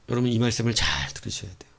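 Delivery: hushed unvoiced whisper. Preacher urges the congregation to listen closely, whispering. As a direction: lean in and whisper it.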